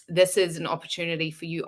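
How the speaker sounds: ordinary talk, nothing marked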